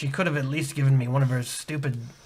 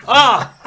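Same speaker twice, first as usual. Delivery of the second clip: pained yelp; 8 semitones higher, 9 dB louder than usual. Acted. Slapped again.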